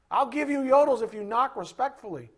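ordinary delivery

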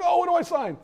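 mock eager CEO. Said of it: "And the C E O's like".

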